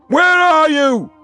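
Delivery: last, longest cry of despair; desperate final cry. A narrator's reading. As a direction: stretch it out until the voice breaks.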